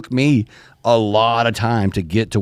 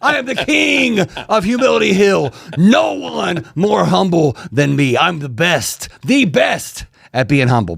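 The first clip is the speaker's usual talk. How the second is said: wrestling promo; 6 semitones higher than usual. Pretend. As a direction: boast like a pro wrestler on the mic. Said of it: "Are we filming a wrestling promo right now?".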